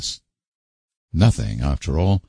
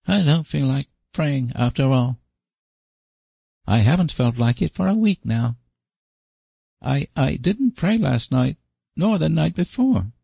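put-on high boy's voice muffled in a lap; muffled little boy; 9 semitones higher than usual. A narrator's reading. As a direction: mumble it into a lap like a child. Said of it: "he said in a muffled voice".

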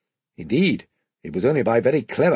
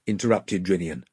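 usual speaking voice

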